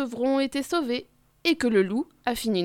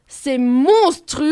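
slow, louder delighted shout; gleeful yell; 2 semitones higher, 7 dB louder, much slower than usual. Acted. Garou shouting happily, loud and slow. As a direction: howl it with glee. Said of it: "hurle Garou tout content".

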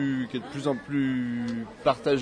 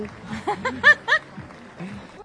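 high embarrassed laugh; 5 dB louder than usual. Real, not acted. Laughs after calling rhyme dumb.

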